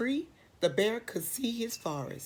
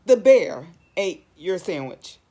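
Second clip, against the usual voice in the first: booming final punchline; loud reveal; 12 dB louder than usual. A narrator's reading. Reader loudly telling the child the bear ate the sandwich. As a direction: boom the ending for effect.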